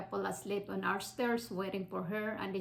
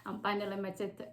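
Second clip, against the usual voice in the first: normal talking voice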